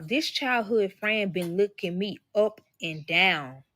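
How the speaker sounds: ordinary delivery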